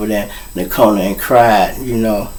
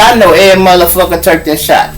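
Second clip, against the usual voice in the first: emphatic insistence; 6 semitones higher, 6 dB louder, faster than usual. Retold or imitated. Insisting friend is innocent.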